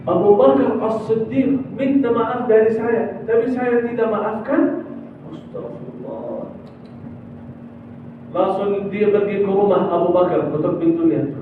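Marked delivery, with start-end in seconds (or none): 5.3-6.6 much softer, lower, slow murmur; hushed repentance. Acted. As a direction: breathe it out in regret. Umar's repentant sigh.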